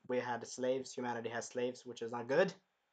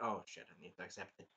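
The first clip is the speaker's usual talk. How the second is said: much softer, lower, fast grumble; muttered realisation; 9 semitones lower, 14 dB quieter, faster than usual. Acted. Naofumi grudgingly giving in, fast low mutter. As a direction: mutter it under your breath.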